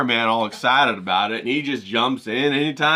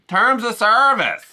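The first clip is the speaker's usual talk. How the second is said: high, slow, drawn-out "Terms of service"; mock panic over YouTube rules, stretched out; imitating [name]; 10 semitones higher, 5 dB louder, slower than usual. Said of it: "hear him say that".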